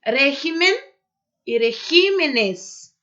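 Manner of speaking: "Régimen" stressed on RÉ, then "regímenes" slow, stressed on GÍ; stress shift shown. Shown as a demonstration. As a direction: move the stress from RÉ to GÍ. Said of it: "aqui nós vamos dizer".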